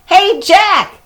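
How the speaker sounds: high, loud cheerful call. A narrator's reading. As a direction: shout down from the hilltop. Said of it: "Annie called".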